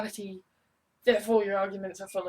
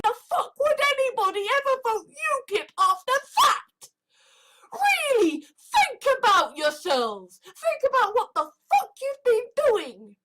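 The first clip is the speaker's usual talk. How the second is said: much higher and louder, yelling in disbelief; furious rant; 5 dB louder than usual. Real, not acted. Raging at UKIP voters, loud and high. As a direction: shout it at the voters, outraged.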